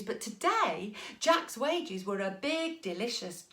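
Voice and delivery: ordinary delivery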